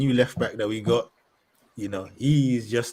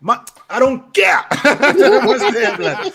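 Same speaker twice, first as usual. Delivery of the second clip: laughing protest; 11 semitones higher, 10 dB louder, much slower than usual. Real, not acted. Laughing dismissal of Kroos point.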